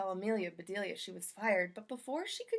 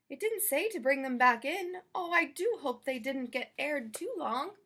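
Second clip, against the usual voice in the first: a higher, lightly voiced eager housekeeper; Amelia Bedelia voice; 5 semitones higher, 5 dB louder than usual. Amelia Bedelia wondering aloud about dressing the chicken, lightly voiced higher. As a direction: sound puzzled but keen about the chicken.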